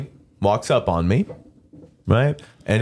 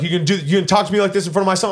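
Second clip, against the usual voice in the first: rushed, higher retold challenge; indignant challenge; 7 semitones higher, much faster than usual. Retelling father objecting in front of son, indignant. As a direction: fire it off fast as the offended father.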